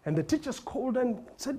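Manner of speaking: ordinary talk, nothing marked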